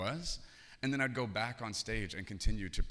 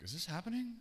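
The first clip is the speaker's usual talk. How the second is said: stunned disbelief; 10 semitones higher, 3 dB quieter than usual. Retold or imitated. Kicked out hours before graduation.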